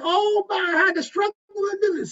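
high, whimpering mock sob story; mock crying contestant. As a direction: fake a tearful plea for sympathy. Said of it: "they start crying".

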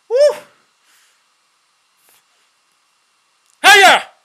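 high, loud yelp; real cry of pain. Real, not acted. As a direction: cry out sharply. Ripping mask off fast.

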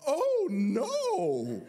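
very high, loud squeaky "Oh, no"; hammed-up gasp of dismay; mock dismay. Dog owner reacting to the bite in squeaky mock dismay.

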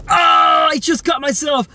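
high-pitched cry of alarm; pained yelp. Real, not acted. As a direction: yelp as the blade slips. Has just cut himself.

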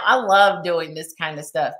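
ordinary talk, nothing marked

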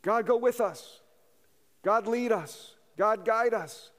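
earnest petition to God; fervent prayer. Retold or imitated. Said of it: "Not bad prayers".

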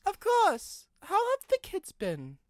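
cheerful guard Chuck; Chuck's voice. A narrator's reading. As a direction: pitch up into Chuck. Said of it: "asked Chuck".

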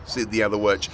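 ordinary delivery

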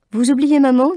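high, loud eager correction; eager interjection. A narrator's reading. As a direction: jump in to correct mother. Daughter corrects her mother.

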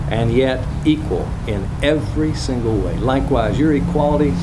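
normal talking voice

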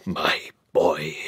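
low, whispered and moved; shaky whisper. Acted. Earl to his grandson, a moved shaky whisper. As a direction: breathe it, overcome. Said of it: "his voice was a little shaky too".

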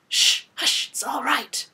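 quick shushing reassurance; insistent shush, then soothing words; urgent hushing. Acted. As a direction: hush someone who is panicking. Rescuer hushing the shrieking heroine.

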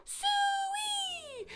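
very high, drawn-out farm pig call. Shown as a demonstration. Calling a pig on the farm, very high and drawn out. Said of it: "that's just how you call a pig".

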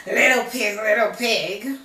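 loud wolf calling; a hint of big bad wolf, loud. Wolf calls.

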